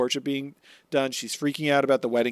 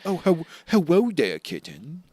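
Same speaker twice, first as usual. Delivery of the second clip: smooth flirt; 4 semitones higher than usual. Retold or imitated. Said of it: "and just goes".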